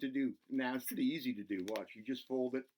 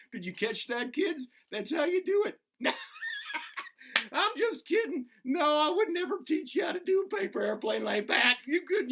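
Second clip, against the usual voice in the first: much higher and louder, laughing through the joke; giddy teasing; 7 dB louder than usual. Acted. Bobby teases the kids after folding too fast, high giddy laughing.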